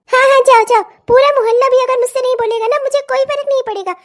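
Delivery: loud defiant dismissal; angry dismissal. Acted. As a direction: wave her off shouting. Mother-in-law dismisses neighbour shouting.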